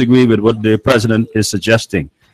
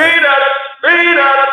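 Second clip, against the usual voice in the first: peanut vendor call. Acted.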